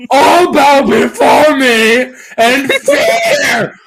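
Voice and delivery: slow, high, booming mock tyrant; dark lord. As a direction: proclaim it like a laughing villain king.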